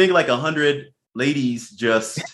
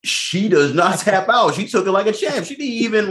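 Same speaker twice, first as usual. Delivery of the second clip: high voice still full of laughter; words squeezed out through a laugh; after laughing hard; 6 semitones higher than usual. Real, not acted. Laughing after Richard Mann story.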